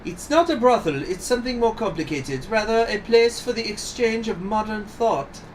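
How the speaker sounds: high-pitched, composed lady's voice; composed accused madame. Acted. Brothel keeper defending herself before the judge, high composed voice. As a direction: defend yourself in a high, proper voice.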